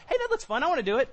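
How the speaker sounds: put-on high-pitched childlike eagerness; eager little child. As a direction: pipe up like a kid wanting a turn. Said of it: "It feels like a little child".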